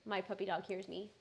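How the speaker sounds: ordinary delivery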